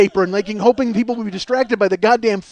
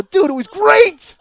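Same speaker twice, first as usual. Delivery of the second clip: laughing; 9 semitones higher, 5 dB louder, slower than usual. Real, not acted.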